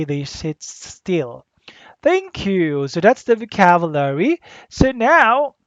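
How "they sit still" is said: each word slow and deliberate, They leaned on; slow it right down: They sit still; slow, stressed repeat. Shown as a demonstration. Teacher repeating for the class, slow and deliberate.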